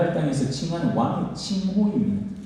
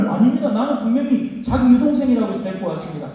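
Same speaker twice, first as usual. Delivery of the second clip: higher, louder panicked protest; king protesting his innocence to God, higher and louder; 7 semitones higher, 4 dB louder than usual. Acted. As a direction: plead your innocence, pitched up. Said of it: "항변을".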